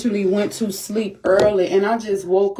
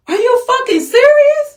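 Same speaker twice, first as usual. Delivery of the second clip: high, loud disbelieving outrage; outraged; 14 semitones higher, 4 dB louder than usual. Real, not acted. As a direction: yell it in disbelief. Outrage at neighbor shooting.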